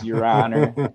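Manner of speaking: mock Mark Richards. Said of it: "sound a little bit like Mark Richards".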